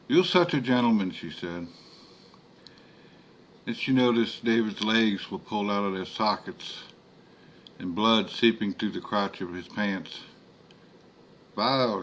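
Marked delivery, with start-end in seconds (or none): 0.1-1.1 Creole woman. A narrator's reading. Philomene voiced by narrator. 11.5-12.0 Creole woman. A narrator's reading. Philomene disgusted at body.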